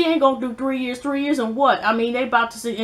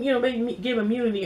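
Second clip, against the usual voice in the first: usual speaking voice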